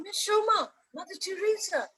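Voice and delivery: high-pitched imitated exclamation; amazed students. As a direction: mimic pupils marvelling at what they see. Students amazed at the screen, acted out.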